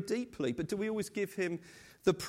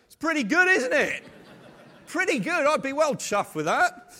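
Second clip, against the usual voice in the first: amused; 7 semitones higher, 6 dB louder than usual. Real, not acted. Jokes about son's drawing.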